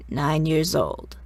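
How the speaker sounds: low, drawn-out rasp; raspy Mother. A narrator's reading. Mother considers the girl's age, slow and raspy. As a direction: draw it out slowly in a rasp. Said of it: "in that raspy voice of hers".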